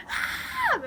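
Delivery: short, very high-pitched shriek; imitated scream. Fleeing the clowns in the tent, imitated scream. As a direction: re-enact a startled scream. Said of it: "I was just, like, screaming".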